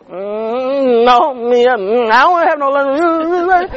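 playfully turning down the invitation; a light imitation of his own flat no; mock reluctant refusal. Retelling his refusal of lunch.

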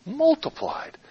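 "Multiplied" high then falling, softly; soft, high, savoring "Multiplied"; stress on MULTIPLIED. Shown as a demonstration. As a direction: lift and ponder "Multiplied" on its own. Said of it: "there's an interesting word".

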